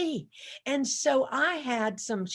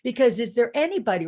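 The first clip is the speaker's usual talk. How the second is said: ordinary talk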